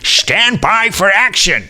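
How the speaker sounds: slow, high dramatic announcement; mock-heroic announcer; show catchphrase. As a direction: draw out the catchphrase like a big TV call to action. Echoing a show's catchphrase.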